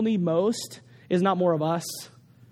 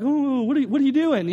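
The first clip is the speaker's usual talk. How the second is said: higher, startled what are you doing; alarmed disbelief; 6 semitones higher than usual. Retold or imitated. Preacher recalls watching rescuer jump, alarmed. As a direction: gasp at a reckless jump. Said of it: "And I'm like".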